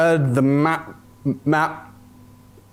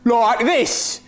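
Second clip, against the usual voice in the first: high-pitched mock Australian twang; mimicked Australian accent; 9 semitones higher than usual. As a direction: parody the Australian accent back at him, pitched way up. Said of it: "are you always going to sound like that?".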